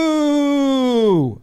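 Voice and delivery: loud drawn-out falling boo; mock crowd boo. Fans jeering the umpire behind the manager, loud mock boo. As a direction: boo the ump like the whole stadium.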